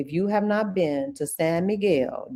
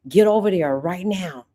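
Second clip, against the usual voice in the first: ordinary talk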